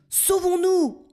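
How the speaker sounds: very high frantic shout; panicked cry. A narrator's reading. Blackbird urges escape from the lion, frantic. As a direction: flee in a panic. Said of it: "hurle-t-il".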